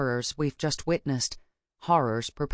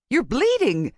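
high, loud shocked exclamation; alarmed; 9 semitones higher, 5 dB louder than usual. A narrator's reading. Alarm at seeing blood.

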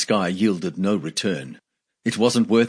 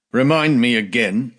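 firm, demanding voice; 3 semitones higher, 4 dB louder than usual. A narrator's reading. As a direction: press him for an answer. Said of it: "he demanded".

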